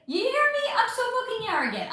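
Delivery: high-pitched, quick disbelief at yourself; jokingly appalled at your own arrogance; mock self-outrage. Real, not acted. Streamer calling herself out for needing a library, mock outrage. Catches herself sounding entitled.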